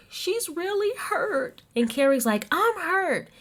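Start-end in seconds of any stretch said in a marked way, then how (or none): 0.1-1.6 very high, drawn-out fake wail; mock crying. Tiffany crying outside about her friend, mock tearful. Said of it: "she starts crying, and she's like". 2.5-3.3 high-pitched exaggerated echo; mock hurt. Carrie answering the crying Tiffany, mock hurt. Carrie mockingly echoes the tearful line.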